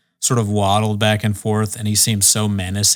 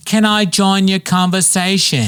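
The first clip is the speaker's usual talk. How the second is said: higher, slow put-on woman's voice; imitating the woman; 8 semitones higher than usual. Stranger at the bar asking to join, in a higher, slow mock voice. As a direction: ask sweetly as the stranger butting in. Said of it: "And she's like".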